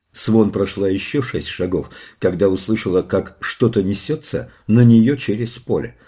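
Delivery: ordinary delivery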